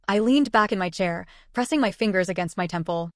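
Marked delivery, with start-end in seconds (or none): none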